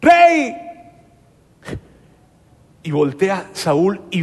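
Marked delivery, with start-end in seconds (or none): 0.0-0.5 grito fuerte y agudo a lo lejos; llama al rey desde la entrada de la cueva; grito llamando. Acted. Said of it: "le grita a Saúl". (David llama al rey Saúl con un grito)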